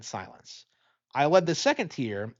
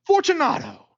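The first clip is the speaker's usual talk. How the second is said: much higher, louder call of "Fortunato!"; calling aloud; 6 dB louder than usual. Acted. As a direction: call into the dark niche. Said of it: "I called aloud".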